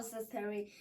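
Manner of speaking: plain reading voice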